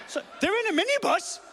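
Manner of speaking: high-pitched, outraged disbelief; incredulous echo. Acted. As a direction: repeat the news as if you cannot believe it. Outraged echo of midwife's news.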